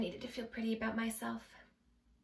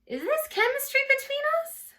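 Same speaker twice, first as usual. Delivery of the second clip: very high, loud giddy inner voice; giddy wondering; 7 dB louder than usual. Acted. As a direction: squeal the thought high and loud, hammed-up. Acted giddy thought while wondering about flirting.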